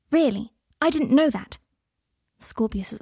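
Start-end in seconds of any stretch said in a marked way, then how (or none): 0.1-1.6 high, loud, delighted surprise; amazed child. A narrator's reading. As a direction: exclaim it in wide-eyed wonder. Boy amazed at news about father.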